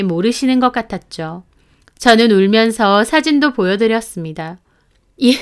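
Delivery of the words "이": higher, louder stunned question; shocked gasp. Acted. Mother-in-law sees the mistress's photo, stunned. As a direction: react in disbelief.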